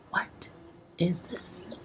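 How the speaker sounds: breathless whisper of awe; hushed, awed whisper. Retold or imitated. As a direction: whisper it, stunned. Quoting own stunned moment in kitchen.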